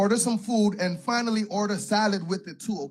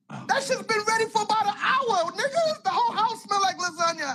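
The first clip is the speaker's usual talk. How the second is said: much higher mock voice of a scolding wife; 13 semitones higher, 3 dB louder than usual. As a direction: squeak it out as the irritated wife.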